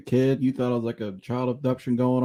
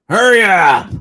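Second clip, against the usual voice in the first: loud, high holler; imitated impatient yell; 9 semitones higher, 12 dB louder, slower than usual. Son mimicking dad at a slow drive-through, impatient yell. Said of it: "Just like that".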